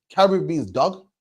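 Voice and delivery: ordinary delivery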